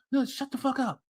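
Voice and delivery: hammed-up outburst of the crying woman; mock woman. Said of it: "she cracks like a fucking walnut".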